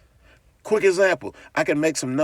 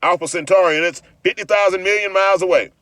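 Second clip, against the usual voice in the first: mocking know-it-all; 5 semitones higher, 6 dB louder than usual. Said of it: "Somebody say".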